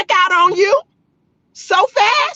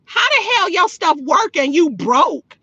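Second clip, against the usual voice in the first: ordinary delivery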